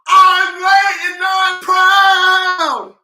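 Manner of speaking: high, loud, slow scream of the catchphrase. Shown as a demonstration. Host screaming the catchphrase with viewers, high and loud. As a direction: belt it out to the whole room. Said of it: "we finna scream".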